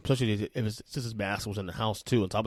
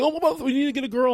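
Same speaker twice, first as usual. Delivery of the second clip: high, quick mock questioning; mimicked nosy people; 14 semitones higher, faster than usual. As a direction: parrot other people's suggestion in a raised pitch. Mimics people urging another child.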